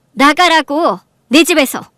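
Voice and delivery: angry command. Acted. Wife throwing the mistress out, angry. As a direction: order her out forcefully.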